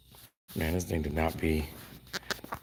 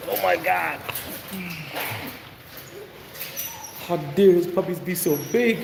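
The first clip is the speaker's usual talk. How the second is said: mock the haters' outrage in a high voice; hammed-up shocked critic, much higher; 10 semitones higher than usual. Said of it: "They're like".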